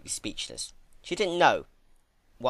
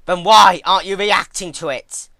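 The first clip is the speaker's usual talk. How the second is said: loud, higher, exasperated question; frustrated loud demand; 6 semitones higher, 14 dB louder than usual. Acted. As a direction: shout it, demanding an answer. Twilight baffled by no reaction.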